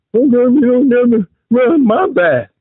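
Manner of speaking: husband mocked as sounding female. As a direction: whine it like a put-on woman. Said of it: "you sound like a female".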